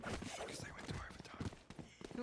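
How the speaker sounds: hushed, breathy whisper, slowed right down. Real, not acted. Caster answers his co-caster's teasing in a slow whisper. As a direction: whisper it like sharing a secret. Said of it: "Rivers, why are you whispering?".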